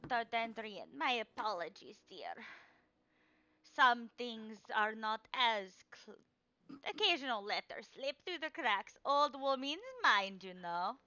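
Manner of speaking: a doddering fortune teller correcting herself; old crone apologizing. Acted. Crone to the player, fussily apologetic.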